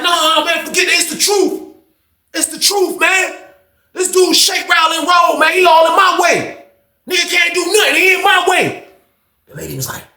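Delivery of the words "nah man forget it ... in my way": heated insistence. Retold or imitated.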